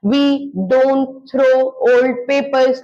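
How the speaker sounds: very slow, each sound clear; slow careful pronunciation. Shown as a demonstration. Teacher showing her pronunciation, very slow. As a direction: show the pronunciation slowly. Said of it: "मेरा pronunciation देखिए".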